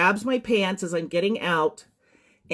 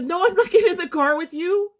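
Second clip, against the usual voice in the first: much higher, rushed retold protest; panicked refusal; 12 semitones higher, 3 dB louder than usual. Survivor retelling her answer to a stranger's offer, panicked. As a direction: blurt the refusal in rising panic.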